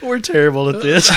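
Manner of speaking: words through laughter; laughing. Real, not acted. Still laughing after joke.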